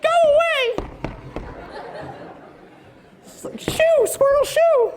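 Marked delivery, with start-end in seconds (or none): 0.0-0.9 shrill grandma shooing. Retold or imitated. Grandma yelling at squirrels from her back door. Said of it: "she just stomps". 3.6-4.9 high, sing-song shoo shoo; rhythmic shooing. Retold or imitated. Grandma chanting at squirrels in her bird feeder. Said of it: "a little rhythm to it".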